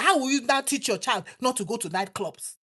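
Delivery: usual speaking voice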